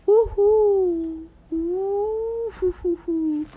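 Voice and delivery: high joyful whoop that trails into slow hoots; excited cheer. Real, not acted. Vlogger celebrating her pride wallpaper with a whooping cheer. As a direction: cheer like you just won.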